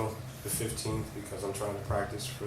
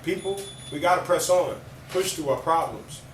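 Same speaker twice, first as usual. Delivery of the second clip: rhythmic alliterative poem, louder; spoken word poetry; 5 semitones higher, 7 dB louder than usual. Acted. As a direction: perform it with punch. Recites poem Perpetual.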